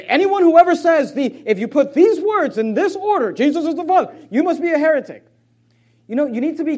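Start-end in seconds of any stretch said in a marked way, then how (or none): 0.0-5.2 loud, high mock outrage; indignant accusation. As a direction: denounce it like an overzealous accuser. Mocks hasty accusers of heresy.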